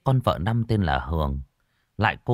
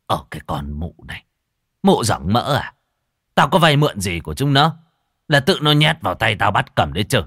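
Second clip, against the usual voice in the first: louder, scornful scolding; angry old man; 2 semitones higher, 5 dB louder than usual. Acted. Husband scolding wife over the debt, louder anger. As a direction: snap back at the foolish wife.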